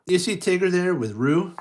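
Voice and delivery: usual speaking voice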